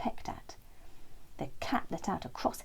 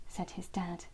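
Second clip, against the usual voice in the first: ordinary delivery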